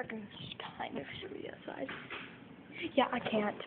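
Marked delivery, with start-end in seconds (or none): 0.0-1.9 whispering. Real, not acted. Sneaking outside at night.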